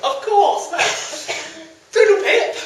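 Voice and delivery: high, loud flustered voice; flustered squeak. Acted. Flustered hero leaves.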